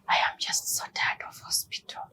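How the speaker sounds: whispered, worn-out complaint; husband whispering that he is sick of hospital; weary whisper. Retold or imitated. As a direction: breathe it out like someone exhausted by hospital visits. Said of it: "he's like".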